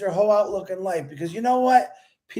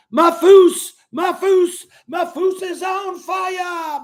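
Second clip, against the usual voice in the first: slow, high, loud calling of the name; loud, stretched shout-out to a fan on fire; hyped shout-out; 7 semitones higher, 8 dB louder, much slower than usual. Real, not acted. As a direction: hype him up, stretching every word.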